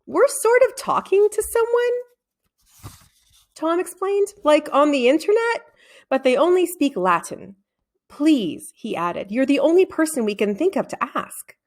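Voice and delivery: put-on a boy pleading politely for help; boyish Tom voice. A narrator's reading.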